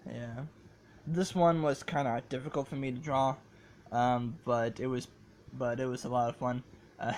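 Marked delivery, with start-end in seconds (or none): none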